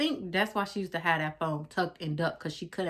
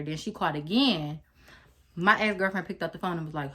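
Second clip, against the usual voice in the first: ordinary talk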